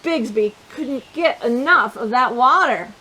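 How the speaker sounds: ordinary delivery, nothing marked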